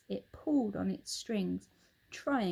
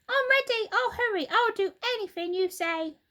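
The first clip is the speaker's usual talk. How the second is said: put-on high, loud, upset old wife; Mrs Twit crying out; 13 semitones higher, 6 dB louder than usual. Old wife cries out to her husband, high and alarmed. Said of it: "cried Missus Twit".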